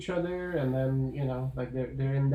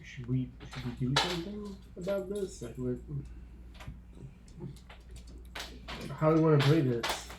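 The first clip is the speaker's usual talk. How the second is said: hushed worried question; 8 dB quieter, faster than usual. Retold or imitated. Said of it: "Klaus is like".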